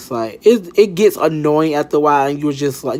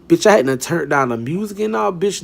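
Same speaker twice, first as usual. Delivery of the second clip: ordinary talk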